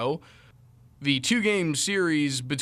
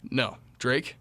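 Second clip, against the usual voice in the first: ordinary talk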